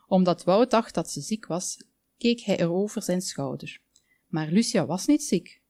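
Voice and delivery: ordinary delivery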